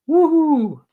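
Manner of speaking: high-pitched woohoo; gleeful, soaring woohoo; celebratory whoop. Real, not acted. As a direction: let out a high whoop of triumph. Celebrates having lost two stone.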